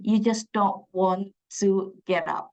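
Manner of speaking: ordinary delivery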